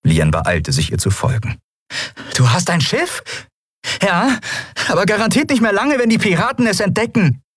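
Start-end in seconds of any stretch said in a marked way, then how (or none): 2.4-3.2 surprised question. Acted. Lien surprised at ship. 4.0-7.4 fast, urgent and high; young woman's voice, hurried, voiced higher; hurried high-voiced character. Endriel hurried answer running.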